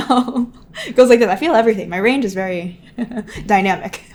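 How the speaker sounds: loud, laughing self-mockery; laughing admission. Real, not acted. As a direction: burst out loudly, laughing through the words. Amused admission of her wide emotional range.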